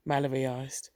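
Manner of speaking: ordinary delivery, nothing marked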